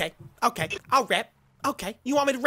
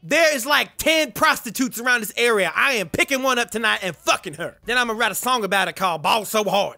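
a put-on Kanye voice, brash and cocky; [name] impression; 2 semitones lower than usual. Trash-talking strangers in game chat, brash Kanye voice. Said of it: "You guys wanna hear my real voice?".

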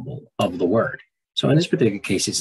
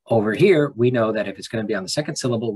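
normal talking voice

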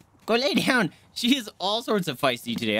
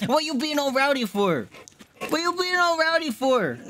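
high doting pet voice, climbing higher; 9 semitones higher than usual. Real, not acted. Owner quizzing the rowdy pet, high baby talk.

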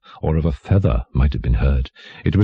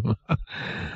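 soft, voiceless trailing chuckle; king's chuckle; 5 semitones higher, 11 dB quieter than usual. A narrator's reading. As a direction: chuckle away to yourself as the king. King laughs at his jester.

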